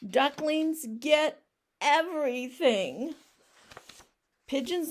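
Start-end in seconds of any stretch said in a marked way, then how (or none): 0.0-3.1 pouting. Acted. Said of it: "he really is pouting".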